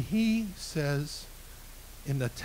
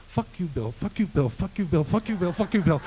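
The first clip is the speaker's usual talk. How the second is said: rapid repeated jabs, pitch climbing; retold cursing tirade; 4 semitones higher than usual. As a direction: imitate an angry caller on a loop. Retold caller's tirade of curses.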